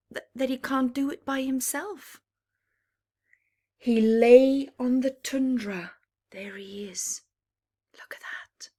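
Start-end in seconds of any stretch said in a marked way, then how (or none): none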